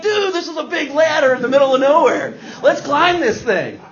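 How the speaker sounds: high-pitched, gung-ho mock tourist; excited American dude. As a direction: egg your buddy on like a thrill-seeking American.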